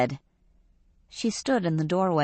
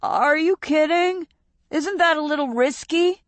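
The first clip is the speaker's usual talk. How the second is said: put-on higher, worried young voice; alarmed disbelief; 11 semitones higher than usual. A narrator's reading. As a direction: sound scared of the idea. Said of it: "Fausto's eyes widened".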